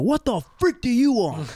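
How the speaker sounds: girlfriend's dad opening the door on him, mock yell; gruff dad greeting. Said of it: "that's how he greeted me".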